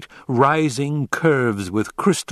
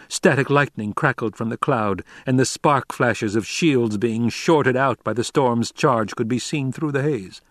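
ordinary delivery

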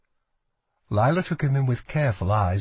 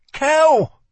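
high, loud urgent cry; alarmed shout of a name; demanding; 8 dB louder than usual. A narrator's reading. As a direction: call out sharply to make him respond. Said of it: "demanded Lila".

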